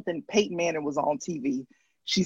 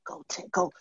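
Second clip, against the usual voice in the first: imitating dad's hushed whisper; soft, whispered nudge to a child; 7 dB quieter than usual. Dad sending little one off to big sister, whispering. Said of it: "I hear him whisper".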